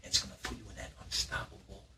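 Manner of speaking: fast, breathy build-up; hyped whisper. Acted. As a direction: whisper the big reveal. Host hypes the challenge in a whisper.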